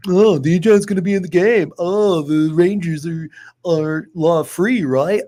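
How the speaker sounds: slow, sneering mock of a doubter; mocking a hater. Mocking the doubters who hate, slow and sneering. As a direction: mimic the haters, slow and snide. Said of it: "All y'all motherfuckers got a little hate".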